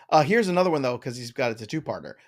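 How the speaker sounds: ordinary talk, nothing marked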